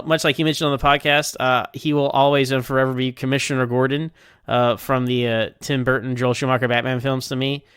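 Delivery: normal talking voice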